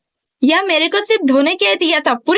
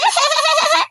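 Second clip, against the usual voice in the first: mocking laugh; 8 semitones higher than usual.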